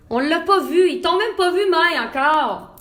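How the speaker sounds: high, loud, stretched at the end; lively exclamation. Real, not acted. Host telling live viewers they missed Maï, exclaiming.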